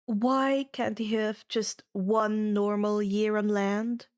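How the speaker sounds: usual speaking voice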